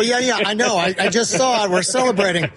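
match a thrilled friend's excitement on the phone; excited. Retold or imitated. Host replaying his reply to the winning player, excited.

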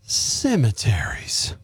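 slow breathy ghostly whisper; spooky whisper. Pretend. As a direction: hush it out like a ghost. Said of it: "trying to sound like a sexy ghost".

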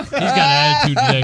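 high-pitched mocking tease; teasing high voice. Teasing Billy about his mood.

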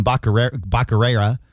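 Bacarera said as bah-ka-RAIR-ah, mocking the way Biden mangled Becerra; Biden's mispronunciation. Host to caller, mocking Biden's Bacarera mispronunciation. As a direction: repeat Bacarera twice, the way Biden said it. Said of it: "as Biden called him".

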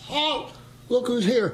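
high yelled halt, then look who's here; sentry repeats his call to the sergeant; repeating the wrong call. Retold or imitated. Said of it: "What'd you just say?".

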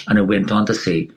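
normal talking voice

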